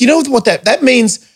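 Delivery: high, mocking retort; retold sass, pitched high; sarcastic comeback. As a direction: throw the boss's words back at him. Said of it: "And I'm like".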